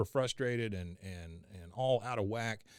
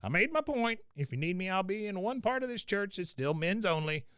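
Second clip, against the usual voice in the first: Cotton voice; 8 semitones higher than usual. Retold or imitated. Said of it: "Cotton says".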